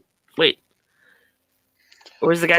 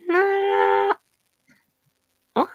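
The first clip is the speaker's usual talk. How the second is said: higher, squeaky little creature "Nah"; Gizmo; 13 semitones higher than usual. Acted. For friends, Gizmo squeak. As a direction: squeak it like the Mogwai.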